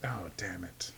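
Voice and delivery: hushed, defeated mutter; defeated whisper. Acted. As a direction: breathe it out in defeat. Voicing Madison's dismay.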